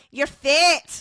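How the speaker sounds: "fit" said the Scottish way, loud; Scottish accent. Shown as a demonstration. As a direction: imitate Scottish movie dialogue. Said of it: "what did they say".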